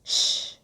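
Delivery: short, firm "shh"; urgent soothing shush. Acted. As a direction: hush someone about to panic. Hushing the panicking listener.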